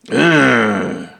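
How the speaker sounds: low, strange grunt like a dim creature; a low monster ugh. Acted.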